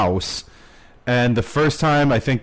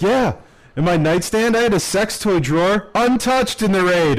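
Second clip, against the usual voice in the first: imitating Kyle; 7 semitones higher than usual. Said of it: "He's like".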